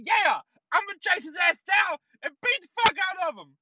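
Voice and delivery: high, hollered mock threat; pissed off. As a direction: imitate a wife ranting in road rage. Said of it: "Like, pissed off".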